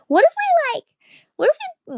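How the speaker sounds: high squeaky excited pitch. Pretend. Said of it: "they were like".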